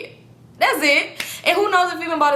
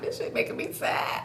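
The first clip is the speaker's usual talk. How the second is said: whispered, teary aside; sad whisper; 9 dB quieter than usual. Real, not acted. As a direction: whisper it, choked up over the cup. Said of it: "getting teary-eyed".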